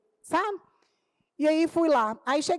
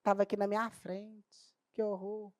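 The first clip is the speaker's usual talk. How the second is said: much softer, slow appalled inner thought; 4 semitones lower, 9 dB quieter, slower than usual. Retold or imitated. Teacher thinking of the doctor's advice, quietly appalled. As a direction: barely voice it, horrified to yourself. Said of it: "dentro da minha cabeça".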